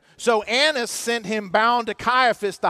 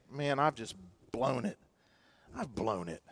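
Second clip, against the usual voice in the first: much softer, dropping low in defeat; defeated murmur; 9 semitones lower, 13 dB quieter than usual. Retold or imitated. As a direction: sink into quiet despair. Listener voicing their failure.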